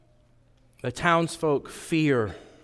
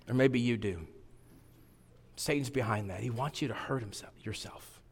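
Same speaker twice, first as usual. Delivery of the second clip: hushed; 4 semitones lower, 10 dB quieter than usual. Real, not acted. Earnest aside to young people.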